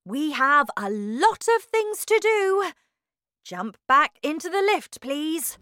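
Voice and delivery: high, loud, excited instructions; eager urging. A narrator's reading. Wonka rushing Charlie into the lift, excitedly urging. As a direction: hurry them along brightly.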